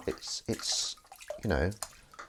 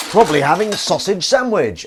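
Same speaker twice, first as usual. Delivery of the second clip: playful proclamation; 9 semitones higher, 11 dB louder than usual. Pretend. Playful announcement of food.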